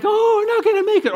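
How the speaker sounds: high, loud panicky wail; mock panic. Passenger freaking out on the deck, mock panicked cry. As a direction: ham up a passenger sure the ship is sinking. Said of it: "freak out all you want".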